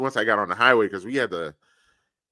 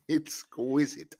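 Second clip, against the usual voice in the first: mock fancy word; 3 semitones higher, 8 dB quieter, slower than usual. Mocking friend's fancy word.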